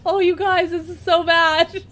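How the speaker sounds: talking through helpless laughter; laughing. Real, not acted. Vlogger to viewers, watching her head in the shot, laughing. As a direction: laugh while groaning at it.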